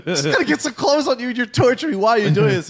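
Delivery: high-pitched exasperated rant; comic frustration. Retold or imitated. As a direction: plead and scold, building to loud. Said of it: "equal parts of frustrated and just laughing".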